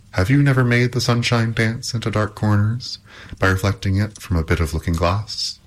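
ordinary delivery

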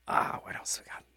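breathy whisper aside. Real, not acted. DJ muttering to himself, whispered. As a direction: whisper it to yourself.

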